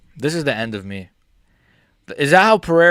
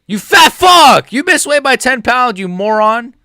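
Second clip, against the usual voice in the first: high, loud, drawn-out screaming insults; furious shout; 12 semitones higher, 12 dB louder, slower than usual. Pretend. Streamer screaming at the fighter who missed weight. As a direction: bellow the insults at him. Mock-yelling insults again.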